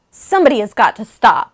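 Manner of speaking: loud exasperated cry; exasperated outburst. Retold or imitated. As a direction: burst out at the noise. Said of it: "then I was like".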